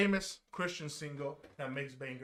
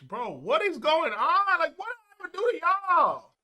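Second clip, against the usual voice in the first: exasperated outburst; 12 semitones higher, 9 dB louder than usual. Real, not acted. Streamer protesting to his haters, exasperated outburst. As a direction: cry out in loud, stretched disbelief.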